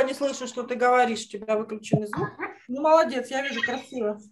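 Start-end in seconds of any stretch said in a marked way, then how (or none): none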